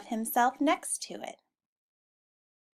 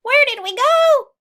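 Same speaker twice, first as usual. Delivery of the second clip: very high, loud squawk; shrieking hen voices; squawking chickens; 12 dB louder than usual. Acted. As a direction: squawk it in a panic like hens. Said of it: "squawked the chickens".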